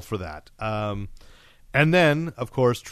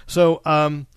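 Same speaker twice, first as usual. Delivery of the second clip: usual speaking voice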